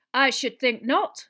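loud, high, slow indignation; reply sharply, each word weighted; sharp schoolmistress disapproval. A narrator's reading. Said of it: "said Professor McGonagall sharply".

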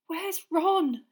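high, scared question; terrified whisper. A narrator's reading. Hermione in the tunnel asking Harry, high scared question. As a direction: ask fearfully in the dark. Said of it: "she whispered in a terrified voice".

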